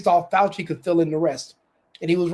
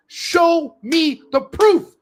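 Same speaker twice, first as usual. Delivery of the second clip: much higher, louder, slow shout on proof; furious demand; 9 semitones higher, 6 dB louder, much slower than usual. Real, not acted. Demanding evidence from the accusers, loud slow shout. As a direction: bellow it out slowly as a challenge.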